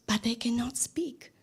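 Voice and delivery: hushed whisper, almost a secret; whispered aside. Real, not acted. Teacher tells students about scientists who cannot speak, whispering.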